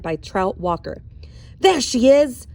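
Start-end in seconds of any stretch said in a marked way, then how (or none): 1.6-2.5 loud, high shout of a mob leader. Acted. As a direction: yell it out to rouse the mob. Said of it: "Trout shouted".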